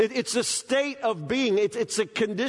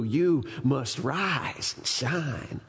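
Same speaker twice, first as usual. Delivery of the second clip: low, very slow rise and shine; slow solemn charge; 6 semitones lower, much slower than usual. Acted. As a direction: press every word home. Sermon climax, slow low.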